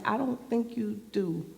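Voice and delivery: doubtful aside. Acted. Acting child's doubtful reply.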